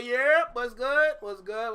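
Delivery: loud, high call-out; hyped greeting. Real, not acted. Streamer welcoming viewers back, hyped loud greeting. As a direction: shout a hello to the crowd.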